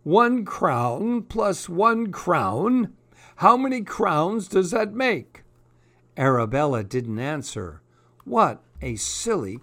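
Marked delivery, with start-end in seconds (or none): 0.0-5.4 high, slow prim teacher voice; drawn-out sing-song royal teacher; theme park princess. Acted. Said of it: "said the royal teacher".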